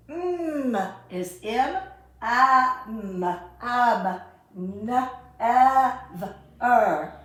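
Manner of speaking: "am" and "ev, er" sounded out loud, syllable by syllable; staccato. Shown as a demonstration. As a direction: chop each sound apart and punch it. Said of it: "So we're gonna mark".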